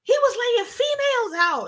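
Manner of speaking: mock a girl defending the rioters; imitating the girl. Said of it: "that's how she sounded".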